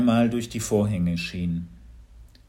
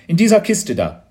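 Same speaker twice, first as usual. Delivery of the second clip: loud, higher-pitched direction; urgent pointing out; 7 semitones higher, 10 dB louder than usual. A narrator's reading. Man showing officers the chest, loud urgent call. Schrepp points out the hiding place.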